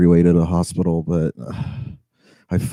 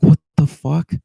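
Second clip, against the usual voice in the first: higher, incredulous outburst; retold disbelief; 8 semitones higher, 4 dB louder than usual. Driver reacting to the rider cancelling, in disbelief. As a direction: react in disbelief, pitch jumping up.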